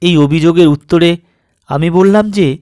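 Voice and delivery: plain reading voice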